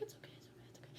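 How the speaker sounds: hushed, rapid soothing whisper; whispered comforting. Retold or imitated. Friend comforting crying Cal in the back seat, soothing whisper. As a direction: whisper reassurance, re-enacted. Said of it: "I'm back here comforting him".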